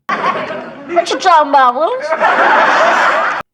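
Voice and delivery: put-on high, loud, slow sassy kid voice; sitcom catchphrase voice. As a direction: ham up the catchphrase with a laugh. Quoted catchphrase, laughing high voice.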